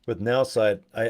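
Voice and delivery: ordinary talk, nothing marked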